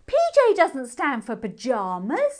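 firm insistence, lifted on J; correcting a cheeky friend firmly. Acted. Girl P J insists firmly to the moon hare. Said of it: "said P J firmly".

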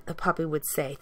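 ordinary delivery, nothing marked

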